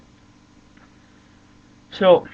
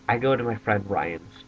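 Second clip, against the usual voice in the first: ordinary talk, nothing marked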